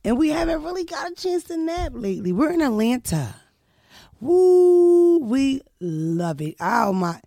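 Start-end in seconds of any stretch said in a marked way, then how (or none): 4.2-6.5 high loud whoop, then slow mock delight; sarcastic enthusiasm. Host pretending to adore Atlanta, sarcastically. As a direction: gush slowly as if thrilled, tongue in cheek.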